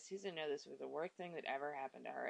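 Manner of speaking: low, soft, flat monotone with no emotion; dry sterile reading. Shown as a demonstration. Reviewer mocking the book's emotionless narration, dry and sterile. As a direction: read it like a detached report. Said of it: "sterile kinda way".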